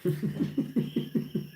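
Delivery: airy high laugh after the shout; breathy laugh. Real, not acted. Laughing at her own shout to viewers, breathy. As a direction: let out a quick breathy laugh.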